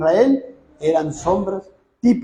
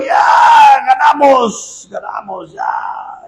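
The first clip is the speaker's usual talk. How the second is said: triumphant shout; 3 semitones higher, much slower than usual. Acted. Preacher voicing the Israelites cheering at the ark, triumphant shout. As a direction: shout it like the battle is already won. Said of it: "los judíos dijeron".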